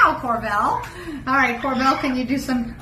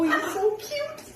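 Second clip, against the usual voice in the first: cooing; 8 semitones higher, 3 dB quieter than usual. Real, not acted. Cooing over pet bird.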